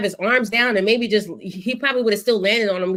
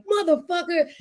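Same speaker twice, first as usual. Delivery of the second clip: angry teammate; 8 semitones higher than usual. Retold or imitated. Crowd yelling voiced again.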